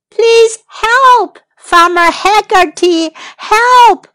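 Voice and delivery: high, loud, urgent cry; pleading high shout; calling for help. A narrator's reading. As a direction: call out desperately to someone far off. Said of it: "called Millie and Molly".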